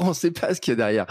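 normal talking voice

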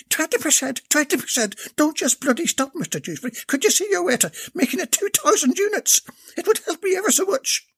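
eager, breathless Irish lass; pleading in a high sweet voice; high young woman voice. Acted. Maeve's voice during the scene.

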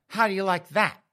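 high, loud challenge; angry taunt. Acted. Girlfriend taunting her boyfriend, angry. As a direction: rub it in after the hit.

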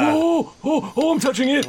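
high, fast, breathless awe; giddy excitement. Acted. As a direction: gush over it like a fan. Barry holds the mace.

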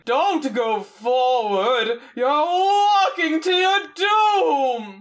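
very slow, rising wail of doom; hammed-up shouting rock face; booming mock warning. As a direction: wail it out high, stretching every word. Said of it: "these rock faces are shouting".

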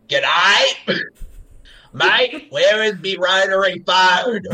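hammed-up Australian accent, drawn out; a mock Aussie bloke calling for his phone; Australian impression. Podcast host showing off his impression to cohosts, hammed-up Australian accent. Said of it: "my impression of an Australian person".

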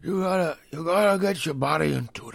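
in character. Pretend. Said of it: "You get so down into that character".

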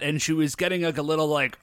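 ordinary delivery

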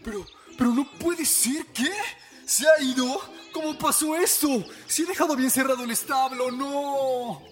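high, alarmed cry of dismay; shocked disbelief. Acted. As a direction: react as if the horse vanished. Horse discovered missing.

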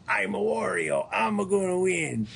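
high, put-on Wario voice with a mock Italian lilt; Wario impression. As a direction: play the pudgy, cocky villain. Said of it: "and he goes".

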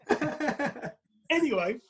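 usual speaking voice

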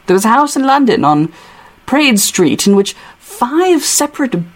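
put-on British accent, reading aloud as Hutch; lightly theatrical English accent. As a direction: read it in a put-on London accent. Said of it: "what my Hutch accent is gonna sound like".

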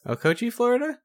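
high, puzzled query on "Okochee"; unsure pronunciation. Real, not acted. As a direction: guess at "Okochee", voice climbing high through "Florida". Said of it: "I legit do not know".

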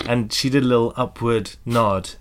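normal talking voice

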